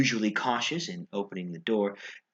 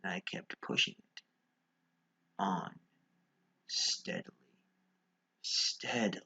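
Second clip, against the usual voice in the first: whisper; 3 semitones lower, 8 dB quieter than usual. Acted. Intruder pushes the door open silently, whispering. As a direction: breathe it out barely audibly.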